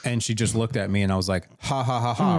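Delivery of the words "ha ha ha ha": spoken-out fake "ha ha"; mock laugh. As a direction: laugh it off with separate, forced ha's. Retelling his disbelieving fake laugh.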